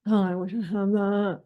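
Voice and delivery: mock wistful, envious sigh of a line; longing, put-on envy; envious wishing. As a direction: voice the envy bells lightly. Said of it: "envy bells or, like".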